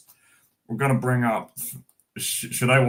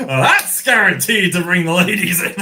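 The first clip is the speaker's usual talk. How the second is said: talking through real laughter, higher and louder; laughing; 8 semitones higher, 7 dB louder than usual. Real, not acted. Joking to chat about attracting ladies, laughing. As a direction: keep laughing while getting the words out. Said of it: "laughing through a joke".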